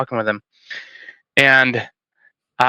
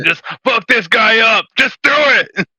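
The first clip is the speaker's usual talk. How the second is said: mock drill sergeant order; 8 semitones higher than usual. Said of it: "They'd be like".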